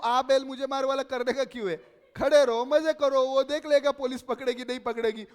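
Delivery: loud, high-pitched exasperated scolding; exasperated retort. Retold or imitated. Speaker recounting friends' reckless lockdown outing, exasperated. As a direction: tell them off loudly, worked up.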